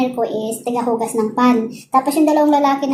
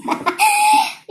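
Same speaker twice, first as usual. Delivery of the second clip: a squealing peal of laughter, far higher. Real, not acted. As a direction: let out a very high laugh. Laughs at her own story.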